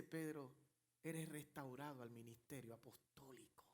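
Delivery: soft, low whisper; the Lord embracing Peter, whispering softly; tender whisper. Acted. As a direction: whisper it lovingly, as a blessing.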